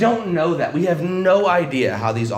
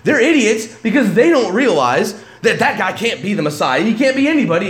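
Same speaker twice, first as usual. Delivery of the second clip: loud, higher mocking sneer; mock the crowd as idiots; scornful Pharisees; 6 semitones higher, 5 dB louder than usual.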